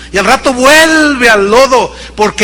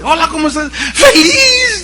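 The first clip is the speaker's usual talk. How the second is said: tearful joy; 8 semitones higher than usual. Retold or imitated. Woman crying with joy, retold.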